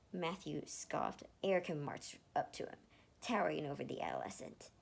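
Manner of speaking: ordinary delivery, nothing marked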